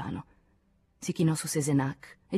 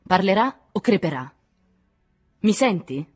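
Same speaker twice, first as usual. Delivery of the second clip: raised, loud threat; vehement, higher-pitched menace; violent; 5 semitones higher, 7 dB louder than usual. A narrator's reading. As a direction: threaten them forcefully. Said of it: "disse Nadine con violenza".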